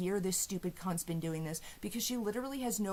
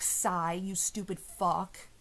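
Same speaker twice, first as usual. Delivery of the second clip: ordinary talk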